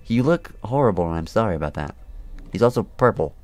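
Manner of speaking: usual speaking voice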